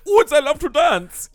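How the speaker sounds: high, loud mock German accent; German pig. Friend recalling an animated movie, high German pig voice. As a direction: squeal it as a cartoon German pig. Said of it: "like, a German pig".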